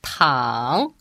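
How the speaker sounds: "tang" dipping low, then rising; drawn-out dipping "tang"; third tone. Shown as a demonstration. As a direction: drop "tang" low and swing it back up. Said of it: "The third tone".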